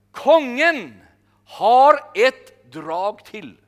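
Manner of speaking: loud, very slow triumphant line; triumphant declaration. Retold or imitated. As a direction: proclaim the king's last move. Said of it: "Så säger han så här". (triumferande och mycket långsamt)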